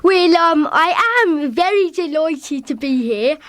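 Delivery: slow, high Cockney voice; Cockney accent. Shown as a demonstration. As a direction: give it a cheeky Cockney lilt. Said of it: "that's just a little example of what I can do, a Cockney".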